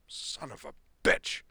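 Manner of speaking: loud, raging curse, slowed; angry snarl. Acted. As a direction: snarl it out in fury. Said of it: "he snarled".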